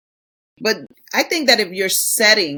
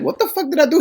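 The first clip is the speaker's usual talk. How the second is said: higher, baffled just-woken husband; confused husband; 8 semitones higher, faster than usual. Retold or imitated. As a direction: play the husband caught off guard. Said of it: "He'd be like".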